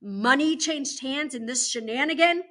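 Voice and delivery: loud, emphatic indignation; outraged warden; angry disbelief. Acted. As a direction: scold with heavy stress on key words. Warden's angry outburst continues.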